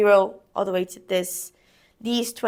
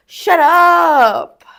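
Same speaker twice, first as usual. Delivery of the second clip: çok daha yüksek ve tiz bir haykırış; loud shout; 8 semitones higher, 13 dB louder than usual. Real, not acted. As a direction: kendine bağırarak çıkış. Exclaiming at her own struggle.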